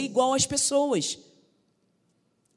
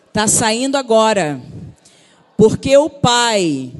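louder, slow, forceful prophetic declaration; booming preacher's decree; strong proclamation; 8 dB louder, slower than usual. Shown as a demonstration. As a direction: proclaim it with full force over them. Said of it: "Vamos falar um pouquinho mais forte".

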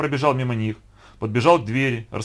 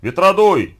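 high, loud director's shout; big commanding yell; 6 semitones higher, 9 dB louder, slower than usual. A narrator's reading. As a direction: call loudly for the machine. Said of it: "закричал Яков Борисович".

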